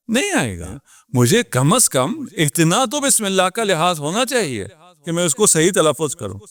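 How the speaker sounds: ordinary talk, nothing marked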